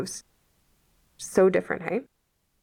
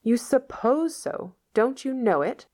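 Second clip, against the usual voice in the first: incredulous; 7 semitones higher than usual. A narrator's reading. Marilla challenges Matthew.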